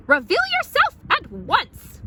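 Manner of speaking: high, loud stern order; commanding. Acted. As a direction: demand like a stern elder sister. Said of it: "shouted Eugenia".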